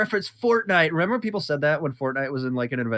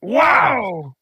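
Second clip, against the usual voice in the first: louder, slow goofy dog; cartoon dog voice; 3 dB louder, much slower than usual. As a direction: drawl it like a dopey cartoon dog. Playing Jake the dog.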